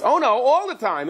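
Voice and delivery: the donkey insisting, a bit high; talking donkey. Acted. Donkey contradicting Bilam, high and indignant. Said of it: "And the donkey goes".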